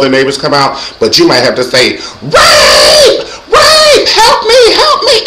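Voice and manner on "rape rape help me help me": high, loud scream for help; yelling for help. Shown as a demonstration. Teaches women to alert the neighbors, high screaming yell. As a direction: scream it out at the top of the voice. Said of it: "And use that voice".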